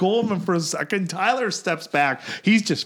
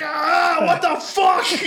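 loud, high, slowed yell of pain; 12 semitones higher, 4 dB louder, much slower than usual. Retold or imitated. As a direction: yell it out like you just got bitten. Being bitten on the arm.

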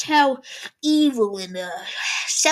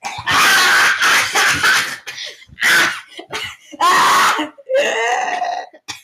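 long, loud burst of laughing; loud laughter; 7 semitones higher, 10 dB louder than usual. Real, not acted. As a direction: crack up loudly.

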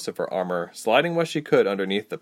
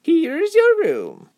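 very high, loud, drawn-out happy voice; high-pitched happy greeting; happy lady; 6 dB louder, much slower than usual. A narrator's reading. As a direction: chirp it slowly as a delighted hostess. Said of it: "the lady answered happily".

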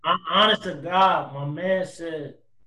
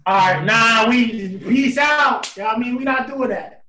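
high, loud flustered shut-down; loud protest; 7 semitones higher, 6 dB louder than usual. Real, not acted. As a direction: cut the topic off, loudly. Shutting down the Biden remark.

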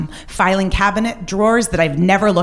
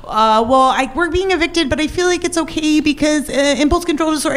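high, nervous imitation of herself; mock panicked explaining; flustered self; 10 semitones higher than usual. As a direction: babble it, much higher and faster. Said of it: "I'm like".

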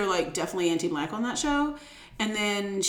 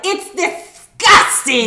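mock disgust; 8 semitones higher, 10 dB louder, much slower than usual. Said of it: "And they were like".

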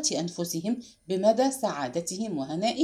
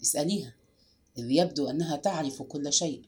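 low, quiet, sullen answer; glum, lowered voice; 7 semitones lower, 5 dB quieter than usual. A narrator's reading. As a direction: grumble it grimly. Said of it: "أجابها باسل بتجهم".

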